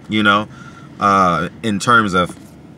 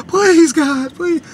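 high, pleading mock prayer; anxious dad begging God before the recital; nervous praying parent; 4 dB louder, much slower than usual. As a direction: beg God like a jittery parent.

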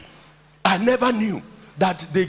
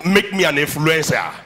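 call-and-response preaching. Acted. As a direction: lead the church in a shout. Leads congregation in declarations.

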